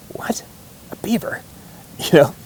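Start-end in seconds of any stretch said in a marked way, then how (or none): 0.1-1.4 whispered disbelief that jumps way up in pitch; hushed disbelief. Retold or imitated. Incredulous hushed reply on hunt.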